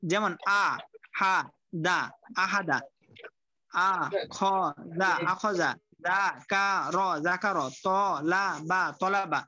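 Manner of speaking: slow, broken reading: তলাবা built from ত, লা, বা; teacher spelling out each syllable before the whole word. Shown as a demonstration. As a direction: say আ, হা, দা one syllable at a time, then join them into আহাদা. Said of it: "উচ্চারণটা, উচ্চারণটা পড়বেন".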